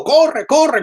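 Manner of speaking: imitated sideline yell, high and loud; pushy football parent urging his kid on. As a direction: bark it like a pushy parent at a match. Parents yelling at kids' match.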